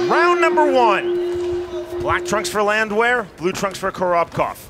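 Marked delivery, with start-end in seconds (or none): 0.1-2.0 drawn-out high round call; booming fighting game announcer. Acted. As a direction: boom it out like an arcade announcer.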